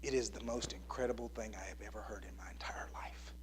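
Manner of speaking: a hushed whisper full of wonder; awed whisper. Retold or imitated. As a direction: whisper it like a dying man hearing angels. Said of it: "And he goes".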